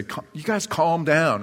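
teacher's voice. Retold or imitated. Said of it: "Our, our teacher would say".

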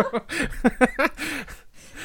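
shrieking burst of laughter; high squealing laugh. Real, not acted. Laughing at a joke.

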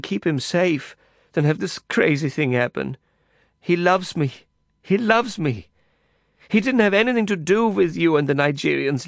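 frightened woman insisting her lover loves her, tearful; distraught Ulla. Acted. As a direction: plead on the edge of sobbing.

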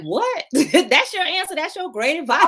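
high, incredulous, laughing through it; mock disbelief. Host mocking her own disbelief at her minister mother's advice. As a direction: act baffled by the advice. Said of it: "I'm like".

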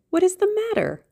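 very high, lively question; high-voiced exclaimed question; girlish cry. A narrator's reading. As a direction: ask it as an excited girl, pitched right up. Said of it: "she cried".

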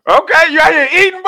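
high, loud mocking yell; teasing shout. Real, not acted. Cohost teasing his friend, loud and high. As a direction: yell the tease across the table.

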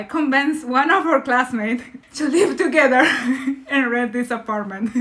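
amused, laughing as she tells it. Real, not acted. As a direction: giggle through the line. Laughing while retelling her win.

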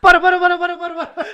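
loud, high laughing protest; laughing, begging them to stop; excited. Real, not acted. Reacting to outrageous question.

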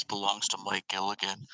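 low, soft mock sultry voice. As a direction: lay on a playful sultry purr. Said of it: "this sultry voice".

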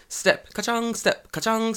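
high bouncy ka-chang, step by step; coin jingle noise. Retold or imitated. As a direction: mimic coins jingling in a pocket. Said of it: "So every step it goes".

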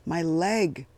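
tight, loud seethe; seething. A narrator's reading. Eddie seething at the captain who shot him, loud and bitter. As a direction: hurl each word at the captain. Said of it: "Eddie seethed".

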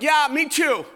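high, breezy brush-off; mock casual agreement; offhand reply. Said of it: "And then it turns into".

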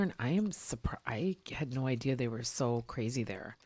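whispering. Real, not acted. Aside about the theater crowd.

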